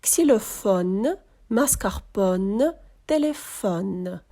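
Ксілофон, маскарпон, телефон slow, final N held, no nasal vowel; drawn-out models Ксілофон, маскарпон, телефон ending on a full N; O as soft E. Shown as a demonstration. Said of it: "О буде перетворюватися на м'який звук Е".

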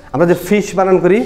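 ordinary delivery

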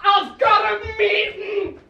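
high, slow drawn-out yell; slow mock shout. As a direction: stretch the shout out dramatically. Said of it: "can everyone shout".

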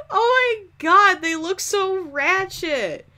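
high, drawn-out disbelief; loud, high, appalled exclamation; amused horror. Real, not acted. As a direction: wail slowly at how awful it looks. Amused horror at ugly muffins.

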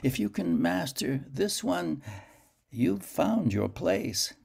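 high, slow and solemn; a spectral guide, unhurried; ghostly dream voice. Acted. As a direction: pronounce it like an oracle. Dream figure speaks to Garak.

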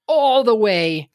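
"all the way" stretched slow and high; drawn out emphasis. Real, not acted. Exaggerating an absurd trek.

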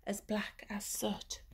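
soft hushed whisper; spooky storytime whisper. Acted. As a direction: whisper the dark image.

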